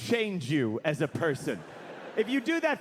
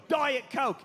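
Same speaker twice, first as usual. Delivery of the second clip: higher, mock-imperious drawl; 6 semitones higher than usual. Ordinary person copying a rock star's demand, imperious. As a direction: command it as if it will just appear. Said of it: "if all of us could go".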